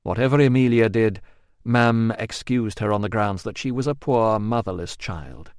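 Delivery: usual speaking voice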